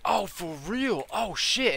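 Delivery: high, drawn-out mock amazement; imitated customer. Customer learns of cheaper mods, high amazed imitation. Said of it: "this dude is talking like this".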